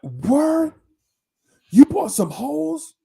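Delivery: mock rowdy shout; loud surprised friend. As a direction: holler it in delighted disbelief. Friend reacts loudly.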